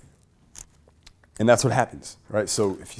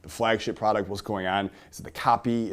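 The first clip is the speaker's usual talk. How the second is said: usual speaking voice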